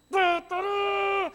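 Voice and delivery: high shouted bugle toot; sung bugle charge; bugle call. Retold or imitated. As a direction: trumpet it out with the voice.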